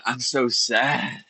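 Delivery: slow, drawn-out, heavy sadness; mournful retold quote. Telling the shaman after the screaming stopped, slow and sorrowful. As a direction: let each word sink with grief. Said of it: "I said".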